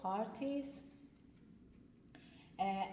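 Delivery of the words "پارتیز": پارتیز raised higher, ending in s; German example word. Shown as a demonstration. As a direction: read پارتیز up high as a model.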